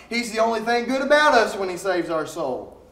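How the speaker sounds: ordinary talk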